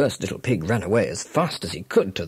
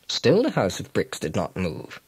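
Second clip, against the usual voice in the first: plain reading voice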